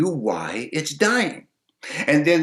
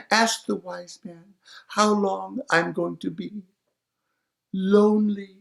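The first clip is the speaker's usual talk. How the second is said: lightly voiced maiden, higher and wistful; lonely maiden; 6 semitones higher than usual. Lonely maiden asking her question, lightly voiced.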